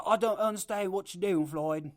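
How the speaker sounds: half-hearted Irish accent impression of the fighter; quoting Conor McGregor in a shaky Irish accent. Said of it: "I don't know the accent".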